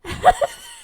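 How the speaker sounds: very high, loud fangirl laugh; squealing laugh. Real, not acted. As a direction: break into a shrieking giggle. Laughs at own screaming.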